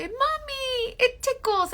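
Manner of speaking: put-on high, childlike son's plea; little son voice. Son giggling to mommy, high child voice. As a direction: squeak it like a small boy.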